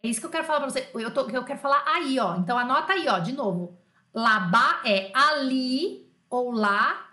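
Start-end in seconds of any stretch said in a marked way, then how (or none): 4.1-7.1 loud, drawn-out key words; slow dictation. Shown as a demonstration. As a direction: spell it out slowly for note-takers. Teacher dictates definition for notes.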